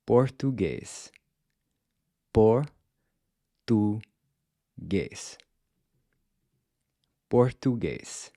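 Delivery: slow careful Português; Portuguese pronunciation. Shown as a demonstration. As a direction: say Português slowly with an unaspirated P. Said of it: "how you'd say Portuguese".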